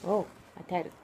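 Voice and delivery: ordinary delivery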